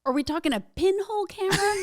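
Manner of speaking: high, loud disbelieving question; asking in amused disbelief; incredulous. Real, not acted. Guest checks if host means a pinhole camera, incredulous.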